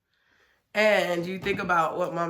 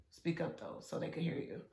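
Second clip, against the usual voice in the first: quiet aside; 4 semitones lower, 14 dB quieter than usual. Real, not acted. Quiet aside to son on camera.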